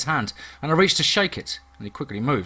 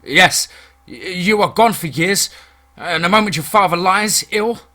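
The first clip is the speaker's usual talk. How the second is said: loud, raised snarling accusation; angry snarl; 5 semitones higher, 7 dB louder than usual. A narrator's reading. Cousin accuses returned James of playing lord, snarling angrily. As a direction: snarl it as a bitter challenge. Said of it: "He snarls again".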